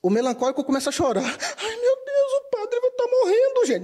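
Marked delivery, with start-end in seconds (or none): 1.2-3.8 high, tearful panic; panicky crying. Retold or imitated. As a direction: cry out in alarm, much higher. Melancholic panics someone is dying.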